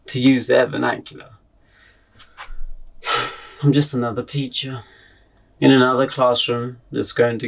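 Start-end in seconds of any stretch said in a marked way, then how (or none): 2.4-3.3 soft, weary exhale; weary sigh. Acted. Teacher resigned to being just another teacher, weary sigh. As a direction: let out a quiet sigh.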